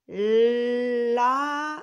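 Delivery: loud, stretched LLLA-MMMA; dictation sounding-out. Shown as a demonstration. Teacher dictating a new word to children, lama drawn out sound by sound. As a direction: dictate lama slowly, holding the l and m.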